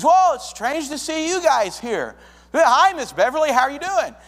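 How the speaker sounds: light up at the surprise visitor; excited greeting. Retold or imitated. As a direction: Whoa and Hi Miss Beverly called out high and bright.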